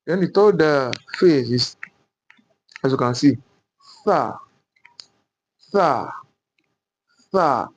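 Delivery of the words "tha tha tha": "tha" with the tongue between the teeth; th as in theta. Shown as a demonstration. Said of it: "like sine theta".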